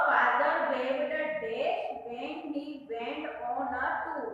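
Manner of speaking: very slow, word by word; careful classroom read-aloud. Shown as a demonstration. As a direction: read the sentence slowly for the children to follow. Reads the exercise sentence aloud.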